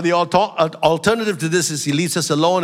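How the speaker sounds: usual speaking voice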